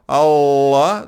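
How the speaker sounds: Allah with the L held for two L's; Allah pronunciado com dois L; double L Allah. Shown as a demonstration. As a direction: model Allah with a long L. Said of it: "dois L's".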